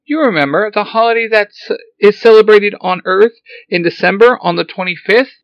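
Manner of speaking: high, loud frantic robot cry; excited robot. Acted. Alpha startled to see Zordon walking, high excited robot. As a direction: fret like a flustered little robot.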